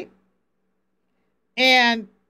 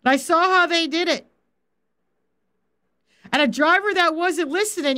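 usual speaking voice

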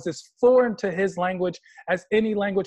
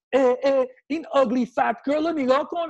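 put-on Iranian accent; 7 semitones higher than usual. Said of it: "They'll just be like".

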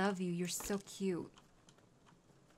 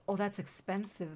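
usual speaking voice

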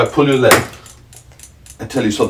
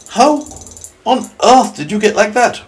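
put-on high-pitched, astonished boy's voice; amazed question; 4 dB louder than usual. James asking the centipede, high and amazed. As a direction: ask it in high, wide-eyed wonder.

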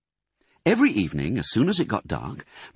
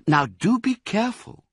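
much higher, anxious wife; Missus Fox. Acted. Missus Fox warning her husband, high and anxious. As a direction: warn him gently. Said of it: "said Missus Fox".

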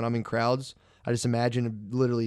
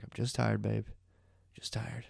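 soft weary; 2 semitones lower, 8 dB quieter than usual. Retold or imitated. Comic retelling telling girlfriend softly he's just tired. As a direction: murmur it, worn out.

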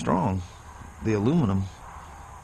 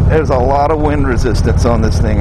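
talking loud over road and wind noise on a moving motorcycle; raised voice over wind; 3 semitones higher, 12 dB louder than usual. Real, not acted. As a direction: project the voice over the engine and wind. Talking while riding motorcycle in wind.

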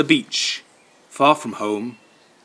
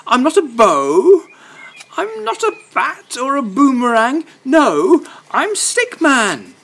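Stickman pleading; 9 semitones higher than usual. Acted. Stickman begging the dog to see him, high plaintive. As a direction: plead to be understood.

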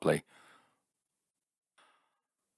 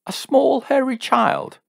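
very high, loud, outraged echo; shrieky indignant question; incredulous demand; 5 dB louder than usual. A narrator's reading. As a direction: throw it back as a high-pitched demand. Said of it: "demanded Jim".

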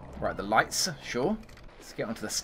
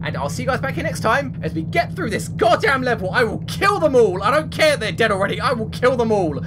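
high, heated rant; exasperated, high-pitched outburst; angry vow; 7 semitones higher, 4 dB louder than usual. Real, not acted. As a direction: swear vengeance on the level, voice raised. Furious vow after losing level.

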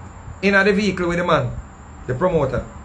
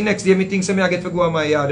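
normal talking voice

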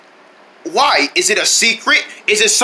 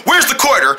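ordinary talk, nothing marked

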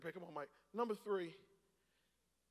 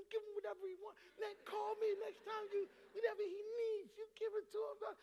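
high, excited mock voice of the owner; hotel owner; 11 semitones higher than usual. Pastor imitating the hotel owner greeting him, high and excited. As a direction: ham up the delighted owner. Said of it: "And he said".

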